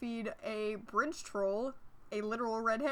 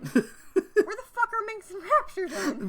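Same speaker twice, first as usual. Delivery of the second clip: high, loud, laughing outburst; outraged shout; 11 semitones higher, 8 dB louder than usual. Pretend. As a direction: yell it in mock outrage. Mock ending outburst.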